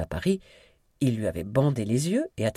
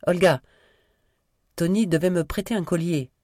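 loud, high answer through a door; calling out; 7 semitones higher, 5 dB louder than usual. A narrator's reading. Daughter answers through the closed door. (appel fort et aigu, à travers la porte)

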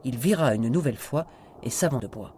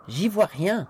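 raised, louder, irritated call back; annoyed; 8 semitones higher, 6 dB louder, slower than usual. A narrator's reading. Man with a torch answering the house, annoyed. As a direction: throw it out with annoyance. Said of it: "lança Elton agacé".

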